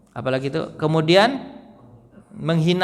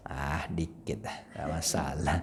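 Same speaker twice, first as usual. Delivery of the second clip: careless shrug; 11 semitones lower, 14 dB quieter than usual. Retold or imitated. Voicing a careless listener.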